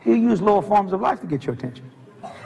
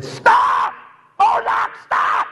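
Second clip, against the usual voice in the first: urgent shout; 3 dB louder, much slower than usual. Retold or imitated. Shouting at dog near road.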